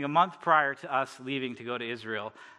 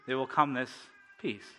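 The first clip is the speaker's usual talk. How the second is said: ordinary talk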